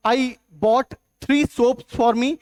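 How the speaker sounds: slowly reading the wrong sentence; slow wrong example. Shown as a demonstration. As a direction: say it very slowly as the error. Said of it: "it's wrong". (गलत वाक्य धीरे-धीरे पढ़ते हुए)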